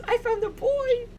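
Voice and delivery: very high, slow, giddy squeak; very happy confiding. Acted. Rosie telling her uncle about a boy, very happy squeal. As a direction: squeal the secret, stretched out with glee. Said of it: "very happy about this".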